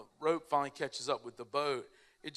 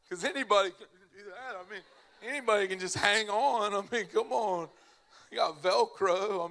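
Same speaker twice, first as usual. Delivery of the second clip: worked up; 5 semitones higher, 7 dB louder than usual. Real, not acted. Defends riding the tube, worked up.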